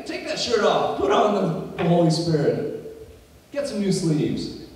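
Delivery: high, loud preaching; impassioned preaching. Acted. Preacher urging believers to put on the Spirit, impassioned preaching.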